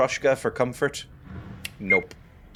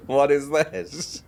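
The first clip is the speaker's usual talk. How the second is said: normal talking voice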